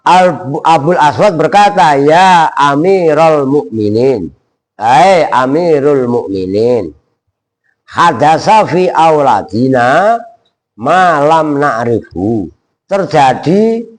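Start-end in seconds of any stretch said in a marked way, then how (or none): 2.0-12.5 slow, measured Arabic quote; slow Arabic recitation. Shown as a demonstration. Teacher reciting the classical Arabic line slowly.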